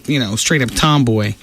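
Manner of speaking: normal talking voice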